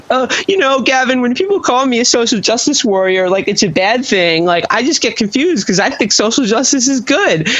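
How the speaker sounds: mocking, high-pitched voice of a hapless guest; imitating a meek guest. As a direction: mimic a weak guest trying to get a word in. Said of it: "the guy was trying to get a word in going".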